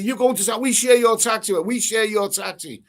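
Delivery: mock the pushy tourist wanting to share; mimicking tourist. Retelling the tourists at the airport, mimicking them insistently. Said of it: "I'm like".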